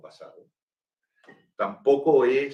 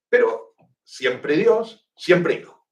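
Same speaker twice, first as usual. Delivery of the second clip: higher, slow and drawn out on Dios; slow emphatic quote; 5 semitones higher, 5 dB louder, slower than usual. Retold or imitated. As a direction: stretch each word to stress the formula. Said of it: "si quisiera decir Alejandro".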